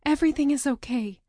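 comforting siblings. Acted. Comforting her crying siblings.

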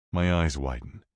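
ordinary delivery, nothing marked

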